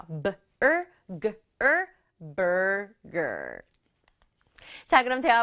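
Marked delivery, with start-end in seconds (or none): 0.1-3.6 "burger" built up from B-er and G-er; sounded out. Shown as a demonstration. Teacher builds the word for learners, syllable-by-syllable "burger". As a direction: break "burger" into B-er, G-er, then stretch it whole. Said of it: "er 하는 소리 내주면 돼요".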